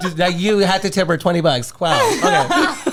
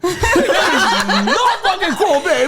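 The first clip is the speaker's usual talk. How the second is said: mock outraged DJ; much slower than usual. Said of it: "who touched my levels".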